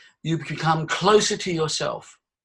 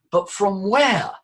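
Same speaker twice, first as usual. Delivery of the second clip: ordinary talk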